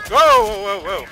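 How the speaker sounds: sudden loud whoa of alarm; startled yell, high and loud; alarm shout. Real, not acted. Sudden danger in firefight.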